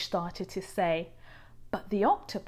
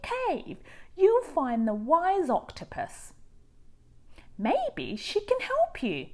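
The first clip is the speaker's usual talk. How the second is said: higher, louder friendly voice; helpful starfish; 11 semitones higher, 7 dB louder than usual. A narrator's reading. Starfish advises rainbow fish, higher and friendly. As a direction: kindly point the way.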